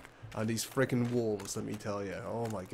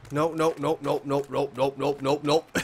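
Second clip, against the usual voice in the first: being chased; 5 dB louder than usual. Real, not acted. Panicked while fleeing wolves.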